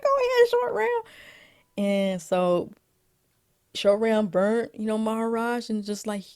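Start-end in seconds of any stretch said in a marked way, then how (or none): 0.0-1.0 squealed mock encouragement; high-pitched cheering. Cheering Short Round punching Maharaj, high-pitched cheering.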